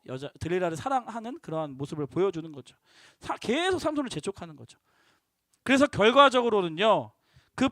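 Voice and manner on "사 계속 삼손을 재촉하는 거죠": ordinary talk, nothing marked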